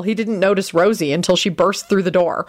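plain reading voice

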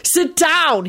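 high, sharp command; yelled order. A narrator's reading. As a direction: order the fighters apart. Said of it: "he yelled".

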